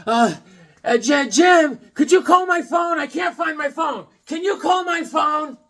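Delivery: high, shouted pleading wife; mocking wife yelling for help finding her phone; whiny wife. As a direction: wail at your husband to call your lost phone. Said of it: "she's always going".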